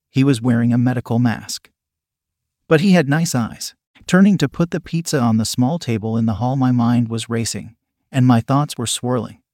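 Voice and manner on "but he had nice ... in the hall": ordinary delivery, nothing marked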